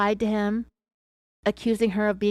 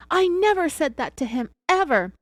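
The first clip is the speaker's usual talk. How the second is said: narrated yell; 7 semitones higher, 4 dB louder than usual. A narrator's reading. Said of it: "Teresa yelled".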